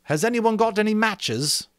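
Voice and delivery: put-on a boy confessing he has no matches; blurted out. A narrator's reading. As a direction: let it tumble out in embarrassment. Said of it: "blurted out the last confession".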